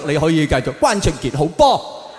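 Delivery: normal talking voice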